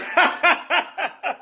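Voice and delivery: high-pitched burst of real laughter; helpless high cackle; big laugh. Real, not acted. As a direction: laugh hard at your own impersonation. Cracks up at own imitation.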